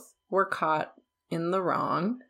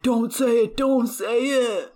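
loud, higher-pitched pleading; urgent plea; 5 semitones higher, 7 dB louder, slower than usual. Retold or imitated. Viewer begging Matt not to reveal the name, urgent. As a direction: shout it at the screen to stop him.

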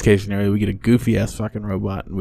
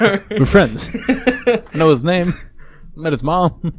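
high and laughing; mock him through laughter; 7 semitones higher, slower than usual. Mocking friend's claim, laughing.

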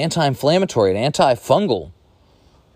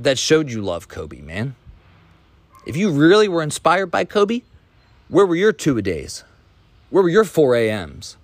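ordinary delivery

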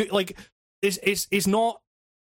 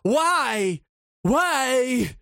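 flat, higher game dialogue; mock the wooden game line; 5 semitones higher than usual. Mimicking the game's flat dialogue, higher. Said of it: "the game will go".

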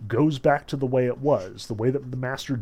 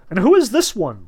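higher, louder squeamish question; 7 semitones higher, 7 dB louder than usual. A narrator's reading. Woland asks who this newcomer is, squeamishly. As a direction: ask it with a touch of distaste. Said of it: "asked squeamishly".

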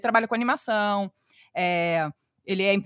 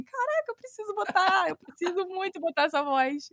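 high-pitched, hammed-up eagerness; excited resolve; 9 semitones higher than usual. Podcaster longs to use a dubber's voice, excited. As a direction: gush in a much higher pitch.

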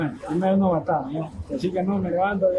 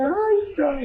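quejido lloriqueado, mucho más agudo; lamento lloroso; 12 semitones higher than usual. Retold or imitated. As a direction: llorica exagerado por la comida perdida. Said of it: "Yo decía". (cuando le quitan la presa, lloriqueo agudo fingido)

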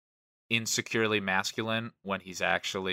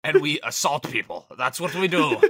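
put-on belligerent drunk; 5 semitones higher, 6 dB louder than usual. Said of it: "such an Irish thing".

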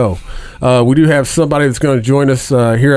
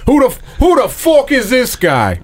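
higher, put-on Irish fighter voice; McGregor impression; 10 semitones higher than usual. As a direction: bark it out like McGregor at the press conference. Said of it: "That's my favorite line of his".

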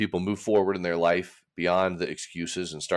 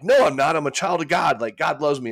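higher and louder, cheering yourself on; rah-rah pep talk; 7 semitones higher, 4 dB louder than usual. Retold or imitated. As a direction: shout back at the doubt like a coach. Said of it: "rah-rahing over here".

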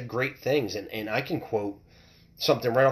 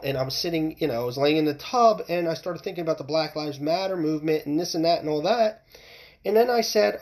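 ordinary talk

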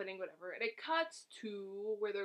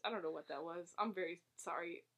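normal talking voice

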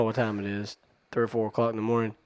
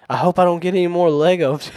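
put-on higher, louder bratty kid whine; 6 semitones higher, 8 dB louder than usual. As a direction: sulk it like a spoiled child. Child's sulky retort voiced.

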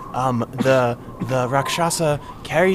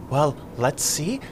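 normal talking voice

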